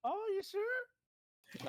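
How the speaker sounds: very high, very slow wheedling; hammed-up clown coaxing. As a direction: stretch it out to tempt him.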